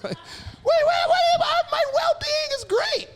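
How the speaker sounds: very high loud excited shout. Retold or imitated. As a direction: squeal it with joy. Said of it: "I'm like".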